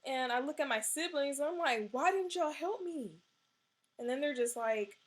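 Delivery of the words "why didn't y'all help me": upset demand. Retold or imitated. Confronting siblings after choking.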